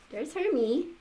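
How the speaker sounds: ordinary delivery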